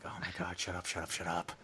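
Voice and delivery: very soft, low, annoyed whisper; whispered annoyance. Retold or imitated. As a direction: hiss it under your breath, fed up. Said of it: "And I'm like".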